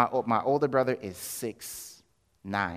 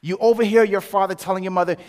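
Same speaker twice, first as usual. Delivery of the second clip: ordinary talk, nothing marked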